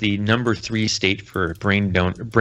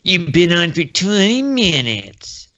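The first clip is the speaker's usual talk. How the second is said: put-on higher, slow, indignant old-man whine; crotchety old man; 7 semitones higher, 4 dB louder, slower than usual. Old man complains to Logan about the time, crotchety whine. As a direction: drag out the complaint like a grumpy geezer. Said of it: "He's doing his best Dana Carvey".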